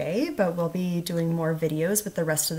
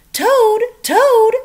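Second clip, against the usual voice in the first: loud, much higher calls for Toad; shouting; 14 dB louder than usual. A narrator's reading. Frog shouting for sleepy Toad to wake up, loud high calls. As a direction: call him out of bed at full volume. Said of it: "shouted Frog".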